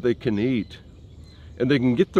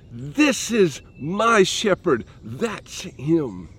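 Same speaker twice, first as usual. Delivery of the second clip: slow, higher, proudly pointing out his shepherd; proud little sheep; 6 semitones higher, much slower than usual. Pretend. The little sheep boasting about its shepherd, mildly proud. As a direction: let the sheep swell with pride over its shepherd. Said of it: "the pride in the little sheep's voice".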